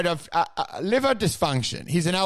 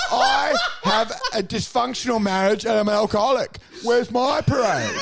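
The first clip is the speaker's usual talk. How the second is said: higher, mock indignant whine; whiny complainer; 6 semitones higher than usual. Mocking complainers who want their own day, whining. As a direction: whine like a spoiled child. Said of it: "and they're like".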